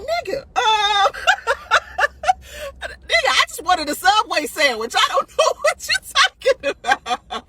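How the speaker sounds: high, squealing retort through laughter; helplessly amused, high-pitched reply; laughing disbelief. Retold or imitated. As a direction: crack up while protesting you're lost. Acts out her amused, baffled reply.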